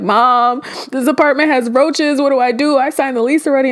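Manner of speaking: high, upset plea down the phone; panicked crying. Retold or imitated. Crying daughter calls her mom about the roaches, high and panicked. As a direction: blurt it out in a tearful panic. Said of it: "So I just started crying".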